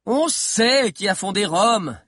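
stretched, high heckle; mild cry. A narrator's reading. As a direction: call out as a showy know-it-all. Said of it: "cria Sabi en prenant des allures de louve".

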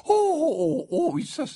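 high, tipsy exclamation; high "oh"s round a stifled burp. A narrator's reading. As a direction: exclaim in a high voice while holding back a burp. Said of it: "s'exclama Slughorn en réprimant un rot".

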